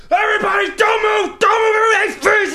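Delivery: high, loud hammed-up gunman yell; robber shouting. Robber storming the sperm bank, hammed-up shout. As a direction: bark orders like a movie robber. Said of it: "and says".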